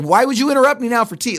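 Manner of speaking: hammed-up offended husband; mock indignant outburst. Husband scolding wife for interrupting, mock indignant, fast. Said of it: "It's like".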